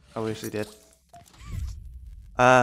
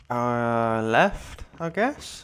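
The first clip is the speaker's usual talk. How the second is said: ordinary talk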